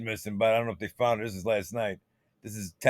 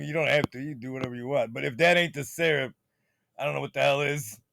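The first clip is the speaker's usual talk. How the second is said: ordinary talk, nothing marked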